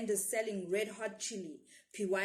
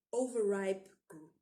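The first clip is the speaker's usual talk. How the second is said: usual speaking voice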